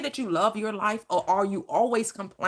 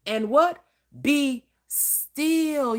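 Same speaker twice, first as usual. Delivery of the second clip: usual speaking voice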